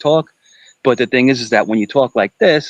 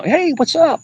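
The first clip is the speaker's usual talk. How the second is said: cheery greeting. Retold or imitated. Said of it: "I engage everybody".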